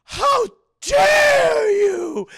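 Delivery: drawn-out, high hammed-up shriek; outraged scream. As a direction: stretch it into a huge mock-outraged scream. Villainess outraged after being groped.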